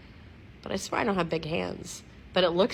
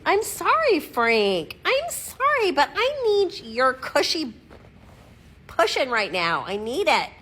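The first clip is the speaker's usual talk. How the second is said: very high, loud, whiny apology; pleading pet-talk; 10 semitones higher, 5 dB louder than usual. Pretend. Owner begging pug for his cushy cushion, high pleading pet voice. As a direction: squeal a playful plea to the dog.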